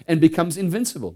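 preacher at the peak of his fervour; full-force preaching. Real, not acted. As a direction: thunder it from the pulpit.

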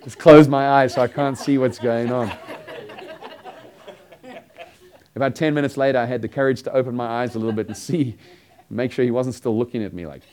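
loud, laughing through the words; can't stop laughing as you tell it; laughing loudly. Real, not acted. Laughing while retelling embarrassment.

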